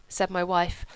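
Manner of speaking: ordinary delivery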